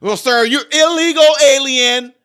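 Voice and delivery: slow, loud and pointed, like scolding a stranger; mock stern address. Vlogger mockingly addresses an imagined person, loud and slow.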